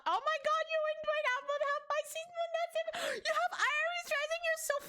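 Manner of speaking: thrilled fangirl shriek; excited squeal. Real, not acted. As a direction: gush about the star signs. Excited reaction to star signs.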